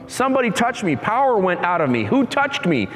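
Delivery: high, urgent insisting; insistent. Retold or imitated. Jesus insists to disciples someone touched him, high urgent. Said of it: "He's like".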